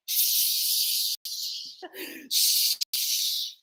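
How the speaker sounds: long hushing shh sounds; whispered shh from beyond; shushing spirit. Acted. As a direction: hush them like a spirit. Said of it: "A talking spirit said".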